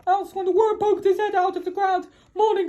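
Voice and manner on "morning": plain reading voice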